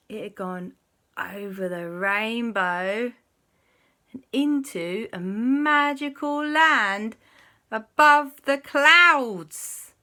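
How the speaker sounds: slow, swelling storybook wonder; grand reveal. A narrator's reading. Storyteller reveals a magical land, slow swelling wonder.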